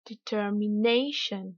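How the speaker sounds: "determination" slow in English, NAY drawn out; slow teacher's "determination", peak on NAY; exaggerated English stress. Shown as a demonstration. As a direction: swell on NAY in "determination", light "shun". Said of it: "je vais délibérément exagérer".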